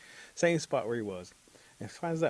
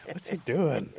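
slow, higher disgusted aside; 6 semitones higher, 5 dB louder, slower than usual. Acted. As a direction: wrinkle your nose at him. Villagers smelling the stink.

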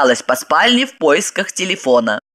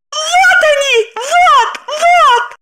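very high, loud hysterical shrieks; hysterical cry; 4 dB louder, much slower than usual. A narrator's reading. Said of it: "истерично закричала". (истеричный крик)

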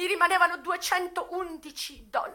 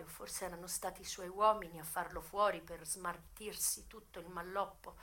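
much softer and lower, numb with grief; subdued mournful narration; hushed grief; 8 semitones lower, 11 dB quieter than usual. Acted. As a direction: tell his death barely above a breath. Grief-struck, subdued telling of death.

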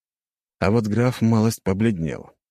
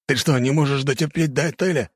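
indignant yelling; 10 semitones higher than usual. A narrator's reading. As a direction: berate him in an outraged, raised pitch. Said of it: "закричал здоровяк".

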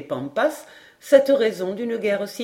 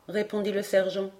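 usual speaking voice

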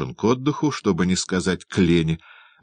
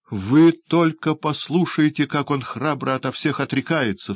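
crying out; 8 semitones higher than usual. A narrator's reading. Young woman appealing to passers-by against Oliver, crying out. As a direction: call the onlookers to witness. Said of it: "вскричала молодая женщина".

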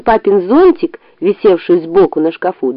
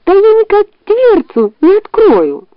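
fox voice; 12 semitones higher than usual. A narrator's reading. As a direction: whine it up high. Fox complains.

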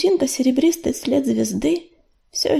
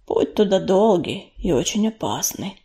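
lower, grave tomcat voice; Ураган; 5 semitones lower, 3 dB quieter than usual. A narrator's reading. Tomcat warns his companions of a dangerous road, low and grave. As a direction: drop the pitch for a sober warning. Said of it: "добавил Роган".

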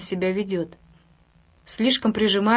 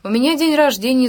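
put-on drunk loud excuse; 5 semitones higher, 8 dB louder than usual. Drunk sailor's defiant excuse.

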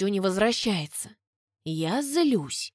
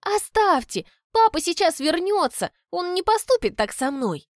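insistent daughter; 9 semitones higher than usual. A narrator's reading.

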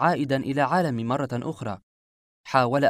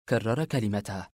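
plain reading voice

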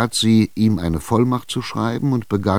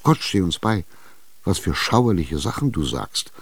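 plain reading voice

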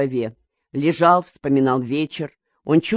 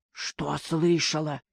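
anxious hush; 8 dB quieter, slower than usual. A narrator's reading. Nervous hungover husband asking.

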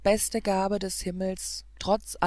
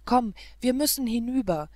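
raised, louder call to come along; higher, pressing exhortation; urgent urging; 4 semitones higher, 5 dB louder than usual. Acted. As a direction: urge your companion onward. Hero urges her across the sea.

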